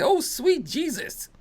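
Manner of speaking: high, slow Southern exclamation; put-on Southern accent. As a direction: drawl it out in a hammed-up Southern accent. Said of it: "it sounds like he would say it like that".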